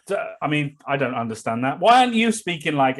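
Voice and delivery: ordinary talk, nothing marked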